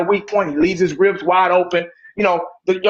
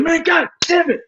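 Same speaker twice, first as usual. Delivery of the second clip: higher-pitched mock outburst over a lost game; mock overreaction; 5 semitones higher than usual. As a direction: ham up a fan losing it over preseason. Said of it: "Not once have we got on and said".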